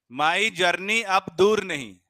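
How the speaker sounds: very slow, loud recital of My journey अब दूर नहीं. Shown as a demonstration. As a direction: stretch My journey अब दूर नहीं slowly and loudly. Teacher recites mnemonic slowly.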